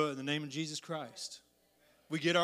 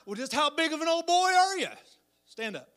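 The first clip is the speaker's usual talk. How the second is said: high, louder mocking challenge; mock bully sneer, much higher; taunting bully; 11 semitones higher, 7 dB louder than usual. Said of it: "they'll say".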